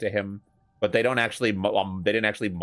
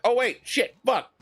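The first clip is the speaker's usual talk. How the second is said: startled swearing; 3 dB louder than usual. Retold or imitated. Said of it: "and I'm like".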